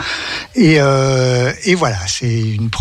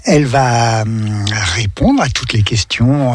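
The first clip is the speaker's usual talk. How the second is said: ordinary talk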